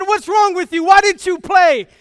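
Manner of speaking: retold angry father; father's anger. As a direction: push harder for an answer. Father presses, angrier.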